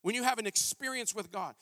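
normal talking voice

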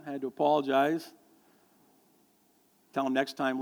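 ordinary talk, nothing marked

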